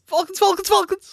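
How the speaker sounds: high, loud cartoon yell; mock shouting. Cohost imitating Boimler's favorite line, high frantic shouting. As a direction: shout it rapidly in a high, strained voice. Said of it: "him shouting".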